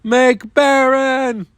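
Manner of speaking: high, drawn-out pretend scream; the card yelling at players, high and slow; mock scream. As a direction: stretch it into a hammed-up cry. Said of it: "screaming at Blue Eyes players".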